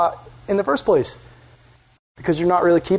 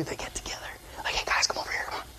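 hushed huddle whisper; very soft, secretive whisper; conspiratorial whisper; 14 dB quieter, faster than usual. Retold or imitated. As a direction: whisper as if gathering plotters.

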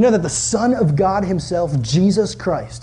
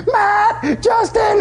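high desperate yell of names; stuck in the mud, yelling to his friends, high and scared; 14 semitones higher, much slower than usual. Retold or imitated. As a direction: call out scared across the swamp. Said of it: "I'm, like, yelling".

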